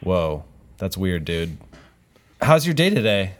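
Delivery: ordinary delivery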